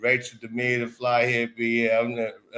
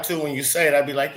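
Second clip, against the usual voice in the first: usual speaking voice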